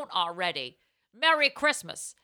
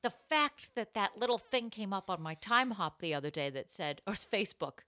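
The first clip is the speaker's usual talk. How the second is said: normal talking voice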